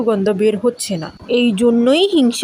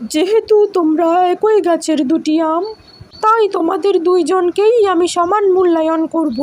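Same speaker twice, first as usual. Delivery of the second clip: higher, gentle fox voice weighing both sides; wise fox; 6 semitones higher than usual. Acted. Fox to the two mangoes, settling their quarrel fairly. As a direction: speak as a calm, fair-minded old fox.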